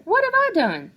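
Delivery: put-on high, loud startled little girl; startled girl's question; child voice. A narrator's reading.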